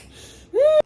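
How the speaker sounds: loud shrieking laugh; shrill cackle of laughter; high squealing laugh. Real, not acted. Reacting to catfish joke.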